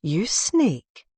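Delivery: lightly admiring, fond teasing; a hint of admiration in a playful jab. A narrator's reading. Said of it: "Alice said admiringly".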